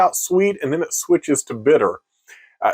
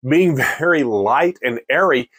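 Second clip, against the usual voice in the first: ordinary talk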